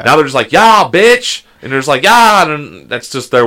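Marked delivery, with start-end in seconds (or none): 0.5-1.4 high, loud yeah bitch; mock youth cheer; hype shout. Imitating today's kids cheering, hype shout. Mimicking young people. 2.0-2.4 high loud yeah; mock youth whoop; hype shout. Imitating kids cheering back, whoop. Mimicking young people.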